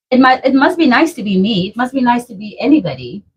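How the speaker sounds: ordinary talk, nothing marked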